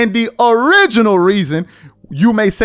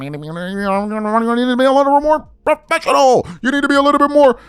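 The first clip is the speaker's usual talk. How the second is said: mumbled mock scolding climbing higher; mocking critic; 4 semitones higher than usual. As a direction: imitate a fussy critic, pitch rising as you go. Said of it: "And your whole thing is".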